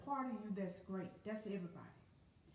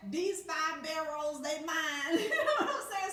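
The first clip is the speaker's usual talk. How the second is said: laughing mafia boast; 6 semitones higher, 8 dB louder than usual. Retold or imitated. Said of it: "mafia boast".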